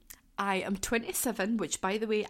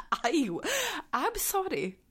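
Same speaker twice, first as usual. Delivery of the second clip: high, breathless giggly sorry; giddy apology; 7 semitones higher, slower than usual. Real, not acted. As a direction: fluster after the laughing fit. Fangirl embarrassment.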